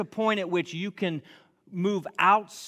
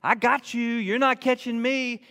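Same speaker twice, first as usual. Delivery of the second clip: higher, slow teasing dog voice; sly dog voice; 6 semitones higher, 5 dB louder, slower than usual. Retold or imitated. Dogs teasing the owner who wants to catch them, higher slow taunt. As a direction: taunt like a dog that won't be caught. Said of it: "they're all like".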